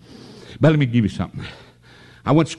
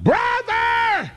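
high, shrill-pitched cry for help; retold holler; much slower than usual. Boy pinned in a fight hollering for his brother. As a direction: yelp it out like a beaten kid. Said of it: "And he hollered".

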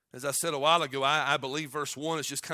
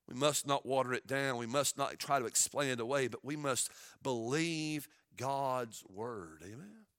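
ordinary talk, nothing marked

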